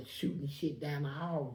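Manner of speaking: normal talking voice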